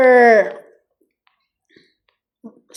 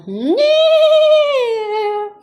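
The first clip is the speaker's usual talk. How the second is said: squealing laugh, much higher; 12 semitones higher than usual. Real, not acted. Owner laughing at her pet in a high squeal.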